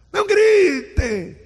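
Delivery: shouting "Não gritem!" slowly and loud. Shown as a demonstration. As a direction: yell it at the kids, hypocritically. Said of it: "Você diz isso gritando".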